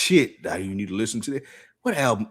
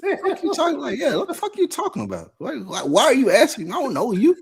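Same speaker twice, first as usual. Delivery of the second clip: laughing disbelief; 14 semitones higher than usual. Retold or imitated. Retelling his baffled inner reaction.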